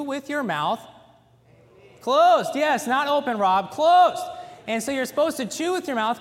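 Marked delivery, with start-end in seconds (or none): 2.0-3.4 loud, sharp call across the room; louder and higher. Real, not acted. Calling out to Rob mid-sermon. 3.4-4.2 loud, sharp call across the room; louder and higher. Real, not acted. Calling out to Rob mid-sermon.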